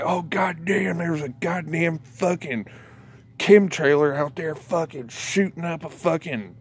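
mock-panicked eyewitness cracking up; mock alarmed local. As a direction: play an outraged witness, half laughing. Mock news report of a hoax.

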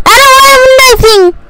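high-pitched whiny protest; high complaint. Real, not acted. Complains twice, high then low.

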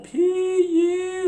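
high squealed pee-yee, hammed up like smelling something awful; mock disgusted pee-yee. Teacher to class, introducing the stinky troll: hammed-up high disgusted squeal.

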